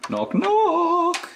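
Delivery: high, sing-song knock knock; playful knock knock. Pretend. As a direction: announce yourself playfully. Playful entrance to flat.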